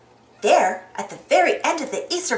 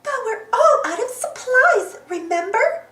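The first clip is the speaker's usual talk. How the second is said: high cartoon worry; high-pitched reminder; Daisy character voice; 9 semitones higher than usual. A narrator's reading. Daisy voiced in read story.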